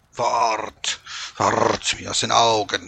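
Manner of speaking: loud snarled dismissal; growling wolf. A narrator's reading. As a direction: growl them away, rolling the r. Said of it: "knurrte er".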